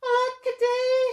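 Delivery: high, loud wail; wailing cry. A narrator's reading. Reader to children voicing the crying dinosaur, high loud wail. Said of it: "And he cried".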